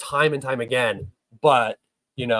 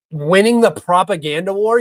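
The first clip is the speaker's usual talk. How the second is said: scoffing, louder and higher, echoing the chat's words; incredulous repeat; 5 semitones higher, 8 dB louder than usual. Real, not acted. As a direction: throw the phrase back in disbelief. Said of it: "You know who else won the propaganda war?".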